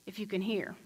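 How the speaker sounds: hushed whisper. Shown as a demonstration. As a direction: whisper "if you can hear" on breath.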